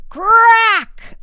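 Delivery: a big, high, loud CRACK shouted out. A narrator's reading. Narrator voicing the egg cracking open, a loud high crack. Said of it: "this time louder than before".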